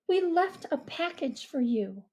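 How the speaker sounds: put-on girl voice, calm. Mia voiced.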